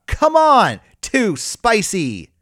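high, loud imitation of a wrestler's yell; mock yell. Podcaster imitating a wrestler yelling at the camera, mock yell. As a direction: yell it out high, stretching the last words. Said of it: "she yells at the camera".

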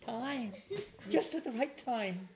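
high, animated imitation of her greeting; excitedly voiced woman at the door; welcoming devotee. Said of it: "Then she said".

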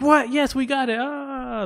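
very high excited squeal; acted jubilation. Colleagues think they got the nomination, high jubilant cheering. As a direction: celebrate like you're jumping up and down. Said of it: "they're, like, jumping up and down".

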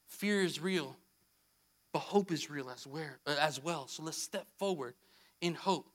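low, soft, reflective sermon voice; subdued preacher, pausing between phrases; hushed solemn preaching. Real, not acted. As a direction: drop down into a quiet, heartfelt appeal.

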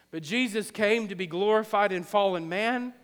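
ordinary delivery

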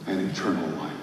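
ordinary talk

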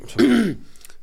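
loud high throat clear. Real, not acted.

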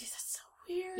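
breathy whispered aside; whispering. Real, not acted. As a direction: whisper it in disbelief. Reacting in a hushed aside.

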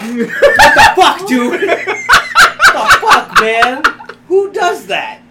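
high, slow, laughing disbelief; laughing mock outrage. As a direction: stretch it out in laughing shock. Mock outraged dog owner.

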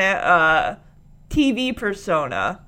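ordinary delivery

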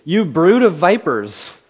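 a prophet's slow, booming denunciation; booming rebuke. Acted. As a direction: call them out, slow and heavy. Preacher voicing John's rebuke.

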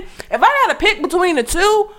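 ordinary talk, nothing marked

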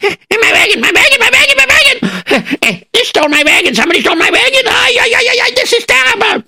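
high, wailing lament; distraught lament. Acted. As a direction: moan over the stolen wagon. Farmer lamenting theft.

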